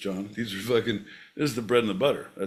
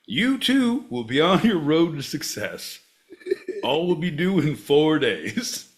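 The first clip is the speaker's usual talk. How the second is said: higher, swooping mock ad read; parody infomercial pitch; 7 semitones higher, 3 dB louder than usual. Pitching a podcast helpline to listeners, hammed-up ad voice. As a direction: sell it like a phone-in commercial.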